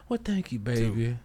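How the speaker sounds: put-on high, soft old lady thanks. Old lady thanking him, voiced.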